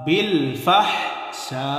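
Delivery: very slow বিল ফাহশা with the long আ stretched; four-count madd. Shown as a demonstration. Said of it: "চার আলিফ টান হবে". (ফাহশা শব্দে চার আলিফ লম্বা টান)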